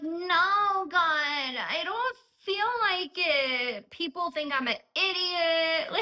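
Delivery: high, drawn-out mock-crying whine; whiny protest. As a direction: whine like a kid refusing a chore. Acted whiny protest to God.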